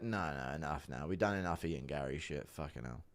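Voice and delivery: low, soft, weary shutting it down; Ian Gary impression. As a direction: mutter it low like you're done. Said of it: "impression of Ian Gary telling Layla".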